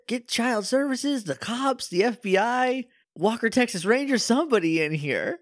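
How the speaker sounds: hammed-up alarm; mock panic. Real, not acted. Urging calls for help.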